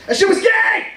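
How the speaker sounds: high, slow campy shout; flamboyant ref. Retold or imitated. Referee calling a foul, high campy shout. Mocking a sports ref's call.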